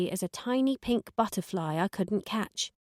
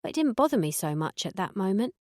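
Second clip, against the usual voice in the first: plain reading voice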